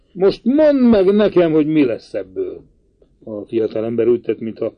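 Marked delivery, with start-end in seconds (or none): 0.1-2.6 pacing uncle demanding an explanation; agitated outburst. A narrator's reading. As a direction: burst out in exasperation. Uncle pacing, exclaiming in distress.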